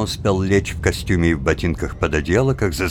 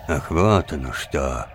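plain reading voice

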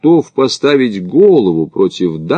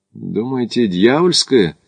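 plain reading voice

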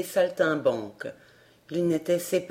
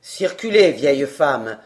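much lower, louder official demand; stern gendarme; 8 semitones lower, 4 dB louder than usual. A narrator's reading. Gendarme to the crowd, lower, louder and stern. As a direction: demand it with authority.

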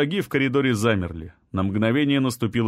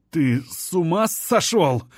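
high, slow, strained croak; croaking, weakened; 6 semitones higher than usual. A narrator's reading. Failing hero asks if his friend is mad, croaking. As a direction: croak it out in disbelief, labouring over the words. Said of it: "прохрипел я".

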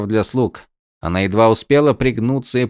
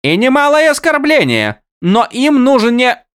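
high character voice; 6 dB louder than usual. Acted.

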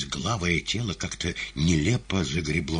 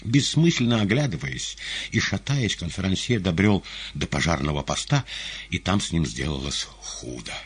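plain reading voice

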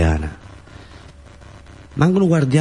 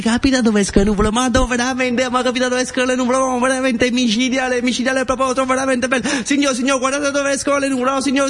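much higher, fast breathless wonder; amazed child. Acted. Boy showing grandfather where clouds come from, thrilled high chatter. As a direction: gush like a kid seeing a miracle.

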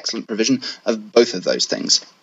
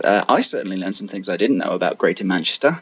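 normal talking voice

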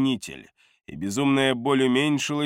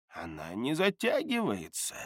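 put-on old man voice; 9 dB quieter than usual. Gerton notes the wound.

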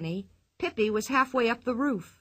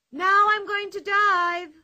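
much higher and louder, slow; a girl's thrilled call before the jump; cried out; 10 semitones higher, 7 dB louder, slower than usual. Acted. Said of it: "she cried".

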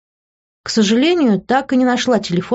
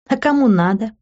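higher, playful question; smiling retort; 5 semitones higher than usual. A narrator's reading. Woman playfully answering his possessive question, smiling. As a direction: toss it back with a smile. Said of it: "улыбнулась я".

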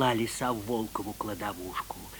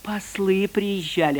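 sly fox voice; 9 semitones higher, 4 dB louder than usual. Acted. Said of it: "отвечает Лиса".

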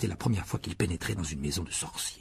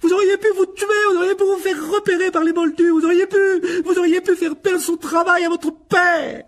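enraged, very high full-voiced shriek; mother screaming in fury; screaming tirade; 11 dB louder than usual. A narrator's reading. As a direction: howl the reproaches without letting up. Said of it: "Elle sembla hurler ainsi".

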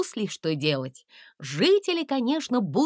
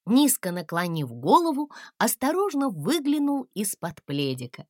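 ordinary delivery

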